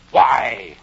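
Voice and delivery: loud, booming caterpillar demand. Acted. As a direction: fire the question loudly, imperiously.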